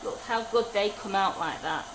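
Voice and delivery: ordinary talk, nothing marked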